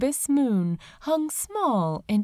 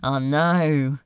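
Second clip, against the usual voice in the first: laughing demon. Acted. Crowley answers basking in light.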